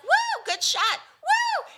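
high excited whoops from the stands; mock cheering. Cheering at daughter's tennis.

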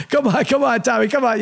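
a laughing high-pitched impression begging it to end; Ray Liotta as Henry Hill. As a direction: plead nervously while laughing. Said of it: "Like, he's".

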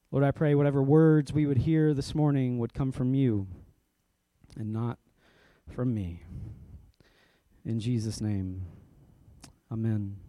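low-pitched, measured prayer with pauses. Real, not acted. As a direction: pray humbly, letting each phrase settle. Leads an opening prayer.

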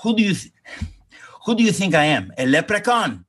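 a hint of the indignant Greek dad; father's retort. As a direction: toss off the line like an indignant dad. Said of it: "And he says to me".

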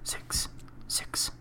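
mock-ominous whispered reading; hushed, spooky joke whisper; ASMR whisper. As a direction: whisper it like a creepy tale.

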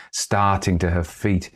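ordinary delivery, nothing marked

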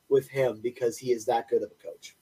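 normal talking voice

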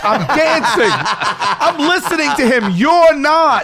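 high, slow, emphatic hollering; retold heated argument. Dancer to seated heckler, retold heated argument. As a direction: spell it out indignantly.

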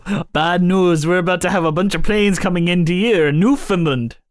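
hammed-up Canadian news-breaker; put-on Canadian accent. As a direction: deliver bad news in a cartoon Canadian accent. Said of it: "do the Canadian voice".

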